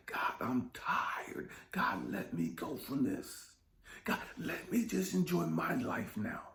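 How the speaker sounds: slow, crying whisper; weary crying whisper. Retold or imitated. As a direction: let it crack into a tired whispered cry. Exhausted plea to God.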